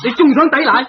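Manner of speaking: a mocking laugh through the words; scornful laugh. Detective laughing scornfully at the suspect who still denies it.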